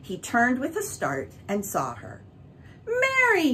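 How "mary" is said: high, loud cry of her name; glad cry. A narrator's reading. Bert greeting Mary with a glad cry. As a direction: call out her name in delight. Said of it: "he cried".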